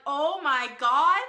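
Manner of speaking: loud, slow, drawn-out exclamation; starstruck shock. Retold or imitated. Fan recognizing the player, acted starstruck shock. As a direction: stretch it out in acted disbelief. Acting out her recognition of him.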